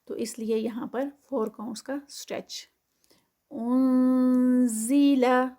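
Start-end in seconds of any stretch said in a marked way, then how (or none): 3.5-5.5 انزیلا with nasal hidden نون; slow recitation. Shown as a demonstration. Teacher models اخفا for pupils, slow recitation. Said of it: "اخفا ہے نون ساکنہ".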